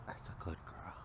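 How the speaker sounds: whispered praise. Real, not acted.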